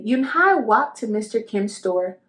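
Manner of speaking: plain reading voice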